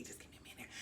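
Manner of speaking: hushed quick request; whispered aside. Retold or imitated. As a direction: trail off into a whisper on the way to the bathroom. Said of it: "actually was like".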